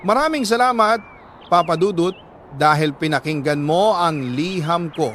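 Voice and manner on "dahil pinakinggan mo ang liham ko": plain reading voice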